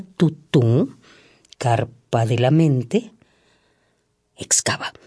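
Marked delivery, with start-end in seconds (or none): 4.4-4.9 soft unvoiced whisper. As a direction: breathe it out. Repeated word, first whispered.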